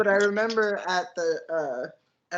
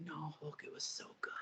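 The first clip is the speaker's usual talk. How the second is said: hushed, savoring whisper; softer, secretive relish; gleeful whisper; 3 semitones lower, 20 dB quieter than usual. Retold or imitated. Gloating confession to Hulk.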